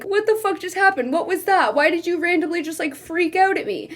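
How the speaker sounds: high-pitched, bewildered mock fright; mock scared. Guy in the corner after her freak-out, mock scared. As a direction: plead for an explanation, acting cornered. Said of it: "He's, like, in the corner".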